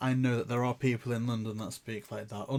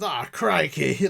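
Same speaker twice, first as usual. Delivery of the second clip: loud, slow mock British exclamation; Hagrid; 3 semitones higher, 8 dB louder, slower than usual. London copper detaining a criminal, loud hammed-up British cry. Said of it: "You sound like Hagrid".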